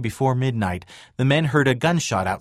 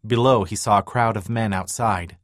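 ordinary delivery, nothing marked

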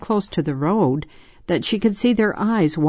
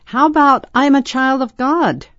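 high, loud suggestion; loud, high-pitched kid's call; 10 semitones higher, 6 dB louder than usual. Acted. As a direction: shout the idea over the storm. Boy calling over storm.

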